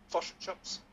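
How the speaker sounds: Kiwi "Fish and chips" with vowels like "fush and chups"; New Zealand accent. Shown as a demonstration. Host showing the way into a New Zealand accent. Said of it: "let's just say you think of a New Zealand".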